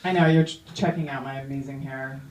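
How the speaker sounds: ordinary delivery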